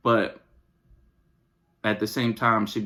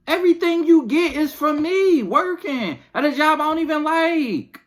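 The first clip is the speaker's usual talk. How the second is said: put-on high-pitched, fed-up plea to the kids; exasperated rant; 14 semitones higher, 4 dB louder than usual. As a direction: whine it high like a parent at the end of her rope. Acted rant continues.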